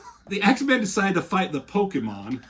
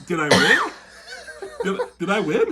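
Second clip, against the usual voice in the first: louder eager laughing question; eager mock question; 5 semitones higher, 4 dB louder than usual. Daredevil at blackjack asking if he won, laughing. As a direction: ask hopefully, giggling.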